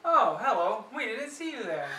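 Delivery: mock surprise. Staged greeting for the camera.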